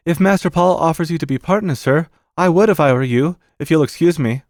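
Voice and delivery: high, deferential servant voice; Bassett. Acted. Servant advising the uncle to join, high and respectful. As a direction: advise politely as a servant would.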